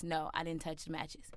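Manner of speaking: soft, level acted denial; straight-faced denial. Child denies touching matches to dad, straight-faced. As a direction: keep it quiet and give nothing away. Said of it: "straight face".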